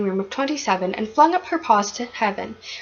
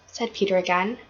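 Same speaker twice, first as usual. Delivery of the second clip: ordinary delivery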